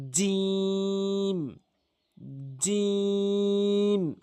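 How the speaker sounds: জিম stretched very long with mid-tongue on the palate; Arabic jim, drawn out. Shown as a demonstration. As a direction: hold জিম slowly like a model recitation. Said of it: "এভাবে উচ্চারণ করবেন".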